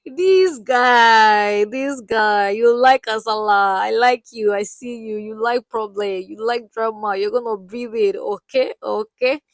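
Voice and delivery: loud teasing. Real, not acted. Vlogger teasing the doctor who likes drama, loud. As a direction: call him out with a grin.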